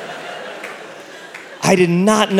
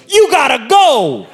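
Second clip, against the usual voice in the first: high, drawn-out urgent order; mock shout; 8 semitones higher than usual. Assistant to the patient waking from a faint, mock shout. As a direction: send them out with a high, slow holler.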